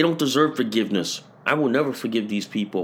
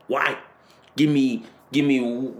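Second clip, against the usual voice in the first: normal talking voice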